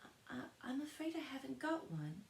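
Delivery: put-on a scared child caught without a ticket; frightened. A narrator's reading. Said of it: "Alice said in a frightened tone".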